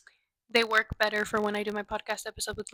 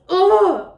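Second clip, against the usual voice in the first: a sharp, loud, high ugh of exasperation; exasperated ugh at the dad; frustrated groan; 8 semitones higher, 10 dB louder than usual. Real, not acted. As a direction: let out a fed-up groan. Frustrated rant about dad.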